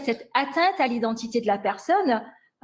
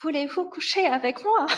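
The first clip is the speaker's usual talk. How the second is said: higher, drawn-out come-on; playful imitation of a flirty stranger; imitated Canadian flirt; 5 semitones higher than usual. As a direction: mimic an admirer's slow, high-pitched pick-up line. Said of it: "on me disait".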